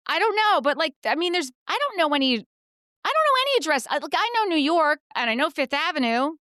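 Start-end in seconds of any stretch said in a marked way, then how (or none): 0.1-5.0 exasperated. Real, not acted. Exasperated over the address.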